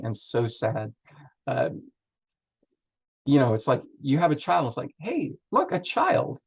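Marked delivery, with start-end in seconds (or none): 5.0-6.4 high, delighted call; delighted exclaim. Retold or imitated. Parent greeting a newborn, high and delighted. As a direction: show off the new baby.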